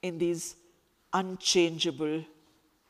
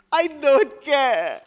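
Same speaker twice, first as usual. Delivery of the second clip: slow, louder, higher and breaking; tearful shout; 8 semitones higher, 10 dB louder, slower than usual. Acted. Crying girl rejecting Khalid's distinction, slow tearful shout. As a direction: spit it out through tears.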